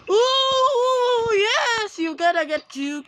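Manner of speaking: high, loud whooping that eases down; excited cheer. Real, not acted. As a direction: whoop in triumph, then settle. Player cheering in game.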